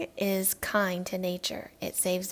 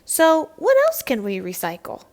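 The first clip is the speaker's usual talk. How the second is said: louder and higher, eager; eager question; 6 semitones higher, 8 dB louder than usual. Acted. Child asks the teacher, loud and eager. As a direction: call it out keen to know more.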